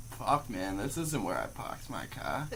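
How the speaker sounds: soft, low deadpan; dead serious. Retold or imitated. Stoned kid addressing a whole classroom, dead serious. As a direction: deliver the absurd line quietly in earnest. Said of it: "Dead serious".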